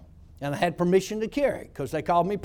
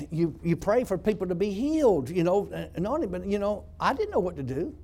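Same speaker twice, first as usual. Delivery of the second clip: ordinary talk, nothing marked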